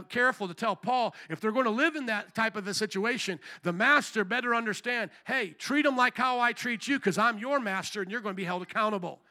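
ordinary talk

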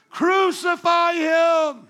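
slow, high and loud mob cry; crowd shout. Retold or imitated. Preacher voicing the crowd that turned on Jesus, with a loud slow shout. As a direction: bellow it out like an angry crowd.

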